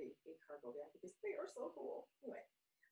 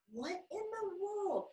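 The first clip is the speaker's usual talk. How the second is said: astonished; 10 semitones higher, 7 dB louder, slower than usual. Retold or imitated. Astonished at ravens.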